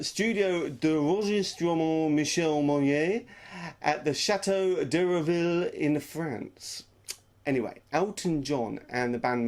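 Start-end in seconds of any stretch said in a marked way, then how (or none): 0.0-6.8 slow, put-on French accent; bad French accent. Shown as a demonstration. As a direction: stretch out the names in a mock French accent. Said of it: "this is the accent bit".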